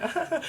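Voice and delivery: high squealing ah ah ah; mock happy screams. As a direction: squeal like a giddy kid skipping. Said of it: "and I'm screaming".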